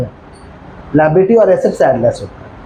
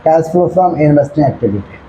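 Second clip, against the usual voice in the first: slow repeated dictation; 2 semitones lower, much slower than usual. Shown as a demonstration. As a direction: repeat it slowly as a check. Teacher repeats dictated heading. (धीरे से दोहराकर लिखवाना)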